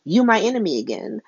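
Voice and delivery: flounce out with a cheeky goodbye; sassy Missus Wu. Retold or imitated. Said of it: "Missus Wu is like".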